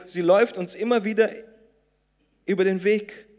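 emphatic pulpit delivery; preaching. Acted. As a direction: preach it out to the congregation. Preaching at full force.

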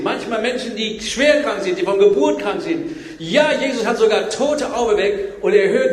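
ordinary delivery

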